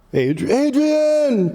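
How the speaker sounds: a long, high, drawn-out cry of Adrian; Rocky calling Adrian. Retold or imitated. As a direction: call it out slow and high like Rocky in the film.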